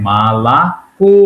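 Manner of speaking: "মালাকো" slowly, two open a's then a long stressed "ko"; changed unstressed vowels. Shown as a demonstration. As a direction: draw out "মালাকো" as ma-la-KO. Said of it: "এর উচ্চারণটা কি হয়ে গেছে?".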